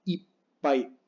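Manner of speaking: ipai with a held stop before "pai"; slow ipai, doubled P as a gap; pause in ipai. Shown as a demonstration. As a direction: break ipai into "ip", silence, "pai". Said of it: "La doble P es como una pausa".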